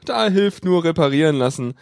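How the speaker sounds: usual speaking voice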